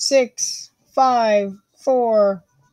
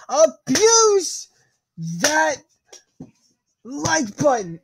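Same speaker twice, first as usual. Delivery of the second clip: hype shout; 6 semitones higher, much slower than usual. Acted. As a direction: push it over the top. Escalated hype call.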